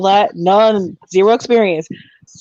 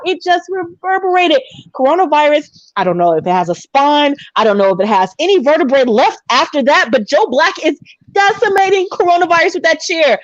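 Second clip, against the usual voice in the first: live play-by-play, rising with the action; wrestling commentary; 3 semitones higher than usual. Acted. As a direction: call the fight like a ringside commentator. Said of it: "you have to commentate".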